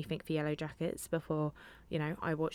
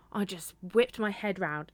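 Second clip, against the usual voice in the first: ordinary talk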